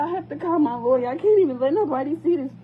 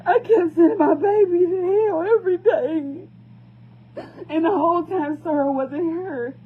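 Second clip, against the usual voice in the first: louder, choked sob with a broken laugh; crying with guilt; 4 semitones higher, 4 dB louder than usual. Real, not acted. Mother blaming herself, crying.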